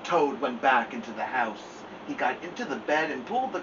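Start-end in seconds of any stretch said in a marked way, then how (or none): none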